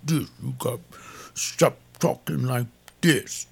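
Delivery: put-on planned stern talking-to for the young man; stern advice. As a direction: tell him straight, no nonsense. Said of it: "I gotta tell him".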